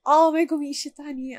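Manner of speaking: ordinary talk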